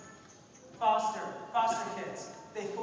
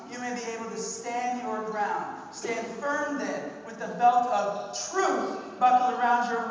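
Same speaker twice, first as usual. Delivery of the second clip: a measured, proclaiming Bible reading; scripture reading; 3 semitones higher, 5 dB louder than usual. Acted. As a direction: read Ephesians like a declaration to the congregation. Reads Ephesians scripture aloud.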